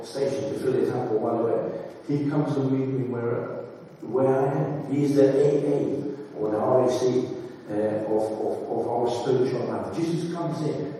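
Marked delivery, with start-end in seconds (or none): none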